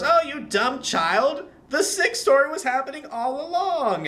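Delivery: high, drawn-out auntie voice; creepy auntie. Retold or imitated. Auntie reveals the sixth story to the kid, creepy taunt. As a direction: stretch it out high, taunting the child.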